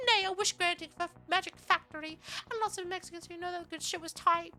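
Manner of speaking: high put-on voice, rising in intensity; Shia. Streamer reciting a burrito poem to chat, high impression voice. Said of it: "do that voice that Shia does".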